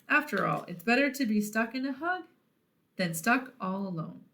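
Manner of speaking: plain reading voice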